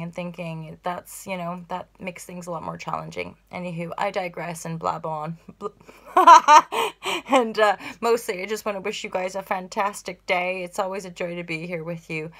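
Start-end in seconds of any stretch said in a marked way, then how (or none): 5.4-7.2 high, loud burst of laughter; loud cackle. Real, not acted. Laughs at own blabbing.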